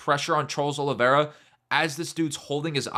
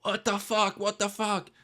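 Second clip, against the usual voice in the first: high-pitched mock outrage; pissed-off coach; 7 semitones higher than usual. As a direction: imitate an incensed coach in a raised pitch. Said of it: "He's pissed".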